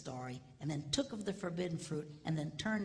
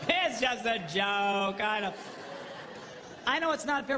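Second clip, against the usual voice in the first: loud laughing reassurance; 5 semitones higher, 6 dB louder than usual. Real, not acted. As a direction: shout it through the laughter. Preacher over laughter.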